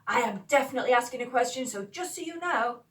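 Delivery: spelling it out with heavy emphasis; really emphatic. Acted. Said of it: "they're like ... being really emphatic".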